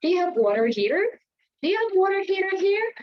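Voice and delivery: American English question; American accent. Shown as a demonstration. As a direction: rattle it off the American way. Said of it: "But in American, they will say".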